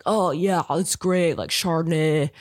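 laid-back surfer dude impression; mock California surfer talk, dragged and casual. Imitating the wine-tasting guy for the listeners, surfer dude. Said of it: "Talking like a surfer dude".

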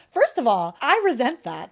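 ordinary talk, nothing marked

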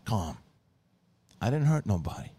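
put-on flat, drunk deadpan denial. Drunk Tony deadpan to Carmela, understated. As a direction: play it dead calm, drunk. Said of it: "Tony very deadpan goes".

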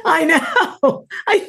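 high, laughing agreement. Real, not acted. Host agrees with guest over an absurd anecdote, laughing. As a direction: squeal it through real laughter.

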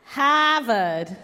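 Harvard with the r dropped, Hahvahd; quick Boston accent on request; laughing through a Boston Harvard. Shown as a demonstration. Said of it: "You're good with accents. I wanna hear you".

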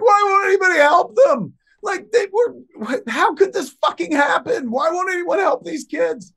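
very high, loud tearful shout; imitating a girlfriend sobbing in panic; hysterical crying. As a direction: wail it like she's watching real deaths. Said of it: "a hysterical Jennifer Schwalbach".

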